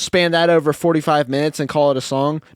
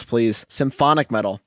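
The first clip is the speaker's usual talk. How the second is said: ordinary talk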